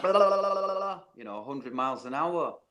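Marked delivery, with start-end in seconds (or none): 0.0-1.0 mock rapid reading, pitch jumping up; fast gabble. Imitating a schoolgirl racing through her reading. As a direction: rattle off blahs like a girl reading too fast. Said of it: "whenever she would read, it was like".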